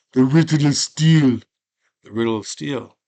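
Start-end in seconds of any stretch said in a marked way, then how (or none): 0.1-1.4 high, loud declaration; proud shout. Retold or imitated. Conan answering Darth Vader, a loud proud shout. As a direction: belt it out proudly.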